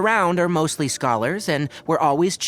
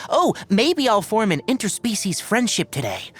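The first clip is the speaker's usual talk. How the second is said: high-pitched naive enthusiasm, sarcastically put on; mocking stupid adventurers; 6 semitones higher than usual. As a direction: mimic a clueless, overeager adventurer. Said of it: "those stupid adventurers whose first thought".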